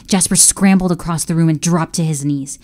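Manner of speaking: ordinary delivery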